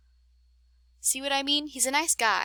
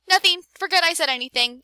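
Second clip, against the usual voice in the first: loud denial; 7 semitones higher, 7 dB louder than usual. Acted. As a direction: blurt it out high and loud. Amelia covers a slip.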